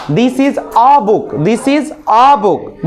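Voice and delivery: this is a book, each word spaced out very slowly; slow model sentence. Shown as a demonstration. Teacher modelling an English sentence for students, very slow. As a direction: lay it out slowly so the class can repeat. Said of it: "अभी मैं बुलवाऊंगा अभी आप लोगों से अंग्रेजी में".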